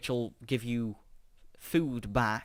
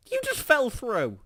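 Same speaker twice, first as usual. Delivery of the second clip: high, loud disbelief; surprised outburst; 14 semitones higher, 9 dB louder, slower than usual. Real, not acted. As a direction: blurt it out in shock. Object falls through wall.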